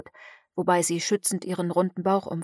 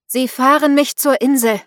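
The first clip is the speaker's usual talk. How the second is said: loud, higher command that leaves no choice; 5 semitones higher, 7 dB louder than usual. A narrator's reading. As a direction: order him, spell in the voice. Witch commands fisherman. (Hexe befiehlt dem Fischer, laut und bestimmt)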